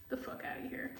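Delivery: whispered "The fuck outta here"; under-the-breath dismissal. Real, not acted.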